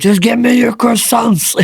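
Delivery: high, loud growly-comic demand; mock demanding yell. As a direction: yell it as a comic order to hand them over. Retelling own demand to guests.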